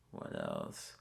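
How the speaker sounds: ordinary delivery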